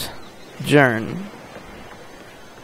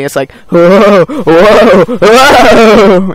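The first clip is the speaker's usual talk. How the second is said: loud wavering whoa; 5 semitones higher, 10 dB louder, much slower than usual. Pretend. Acting the tingling feeling.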